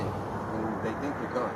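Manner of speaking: ordinary talk, nothing marked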